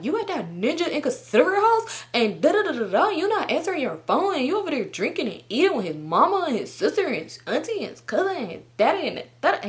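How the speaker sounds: high-pitched imitation of a jealous tirade; mock accusing rant. Boyfriend listing everyone at the house, mimicked rant.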